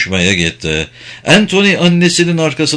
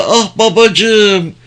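high, slow gush of delight; drawn-out, high girlish exclamation; excited cry; 8 semitones higher, 3 dB louder, much slower than usual. A narrator's reading. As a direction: exclaim to daddy in thrilled joy. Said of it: "diye haykırdı".

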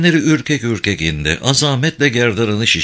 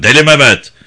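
louder echo, "Deli" hammered home; loud repeat; 6 dB louder than usual. Acted. Director repeating his name at the guard, loud emphatic repeat. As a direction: shout the name back at him.

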